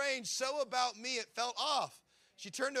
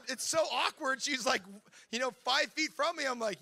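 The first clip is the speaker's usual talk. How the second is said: usual speaking voice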